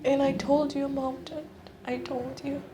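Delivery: high, small, wounded voice; high-pitched hurt, dropping in volume; hurt reproach. Acted. Reproaching aunt who ignored abuse.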